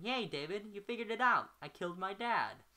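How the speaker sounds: loud, high sarcastic celebration; bitter mock cheer.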